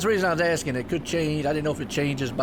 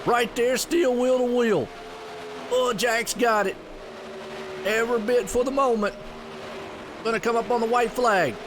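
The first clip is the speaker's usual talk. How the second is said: excited, higher live race call; race play-by-play; 4 semitones higher than usual. Acted. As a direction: call the race lap by lap with energy.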